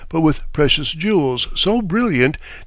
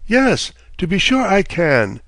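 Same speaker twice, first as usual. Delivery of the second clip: lightly bold; 6 semitones higher than usual. Acted. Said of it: "said the old dame boldly".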